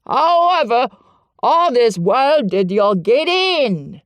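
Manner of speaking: loud, slow Yorkshire question; curiosity bursts through anger; gruff curiosity. A narrator's reading. Ben demands of Mary, loud and curious. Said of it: "overpowered by curiosity".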